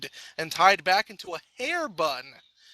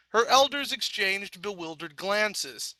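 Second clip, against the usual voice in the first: usual speaking voice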